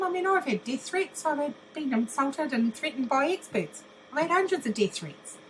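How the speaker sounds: normal talking voice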